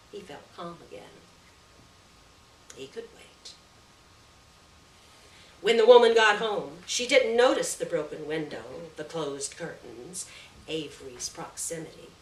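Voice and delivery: usual speaking voice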